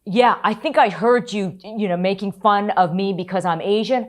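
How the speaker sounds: loud, high accusing retort; angry confrontation. Retold or imitated. Reporter accusing the hecklers in the circle, loud and angry. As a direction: call them out to their faces. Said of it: "I mean, I lost it".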